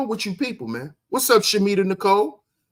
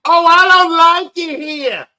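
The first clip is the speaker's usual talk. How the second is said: loud, high, drawn-out mock whine; mock a complaining child at full volume; imitating a child's noisy protest; childish complaint; 9 semitones higher, 8 dB louder than usual. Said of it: "That's what children do".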